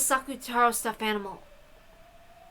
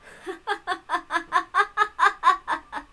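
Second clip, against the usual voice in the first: high, loud witchy cackle bursting out; unrestrained high laughter of a witch; 9 semitones higher, 4 dB louder than usual. Acted. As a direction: finally let the laugh break loose. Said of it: "burst out laughing".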